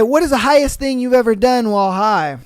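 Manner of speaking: usual speaking voice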